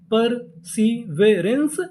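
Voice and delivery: very slow perseverance, drawn out with a rise at the end; slow sounding out. Shown as a demonstration. Teacher slowly blending the codes into the word for students. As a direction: pull perseverance apart, piece by piece. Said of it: "तो पूरी meaning क्या बनी?".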